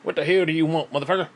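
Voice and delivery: lightly voiced gruff phone greeting, cursing; Jim. Answering an unwanted caller as Jim, lightly put-on cursing voice. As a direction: answer the phone with a touch of mock hostility. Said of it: "I answered the phone as Jim".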